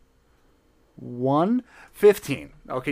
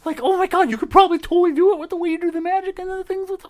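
high excited squeal; 9 dB louder than usual. Acted. Character gushing about heist.

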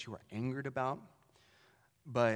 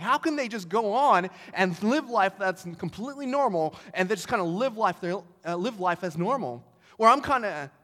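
retold anger; 6 semitones higher, 6 dB louder than usual. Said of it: "he's just livid".

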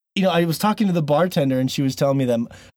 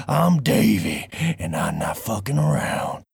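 lower, slow gruff cowboy drawl; 3 semitones lower, slower than usual. Shown as a demonstration. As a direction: introduce yourself like a tough ranch hand. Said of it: "let's hear our best cowboy voices".

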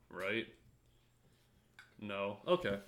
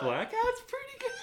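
squeaky voice; 3 dB louder than usual. Pretend. Joking high squeal.

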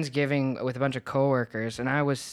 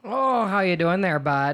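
hammed-up Minnesotan greeting, higher and louder; Minnesota accent; 5 semitones higher, 5 dB louder than usual. Joking with the host, thick Minnesota accent. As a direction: play a folksy Minnesota local saying hi. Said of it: "where did you get that accent from".

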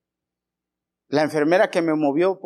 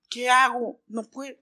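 higher, pleading voice of a sick man; sick man begging Jesus, higher and pleading; 10 semitones higher than usual. Acted. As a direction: beg the Lord from a lifetime of waiting. Sick man answers the Lord.

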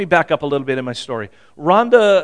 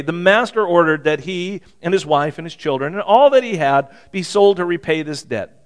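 ordinary delivery

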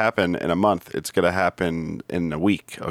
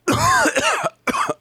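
water bubbling in mouth; bong gurgle. Acted. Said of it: "making it sound like I was smoking a bong".

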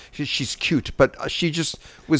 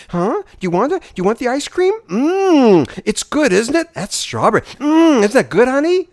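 put-on high, sugary voice for a toddler; baby talk; 11 semitones higher, 4 dB louder than usual. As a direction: coo at a little kid over ice cream. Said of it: "baby talk".

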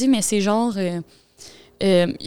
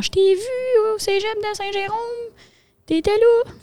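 high mock fan voice; 12 semitones higher than usual. Fans writing to her.

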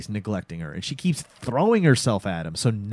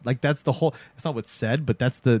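ordinary delivery

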